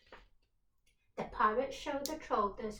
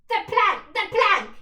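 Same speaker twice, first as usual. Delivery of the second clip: much louder and higher pirate yell; 10 semitones higher, 18 dB louder than usual. Acted. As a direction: bellow it for the crowd. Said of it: "yelled Ben Buckle and Percy Patch".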